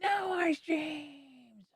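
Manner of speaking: slow, high whiny lament; high slow mock whining; mock sulking. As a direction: whine it out, stretched. Sulking over lost streams.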